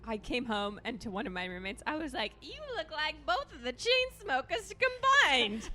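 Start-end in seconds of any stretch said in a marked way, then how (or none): 2.4-5.7 high, drawn-out retold drunk announcement; drunken exclamation, imitated. Telling a roommate he looks like the Chainsmokers, drunken exclamation. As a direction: draw it out slowly and high, mock-drunk.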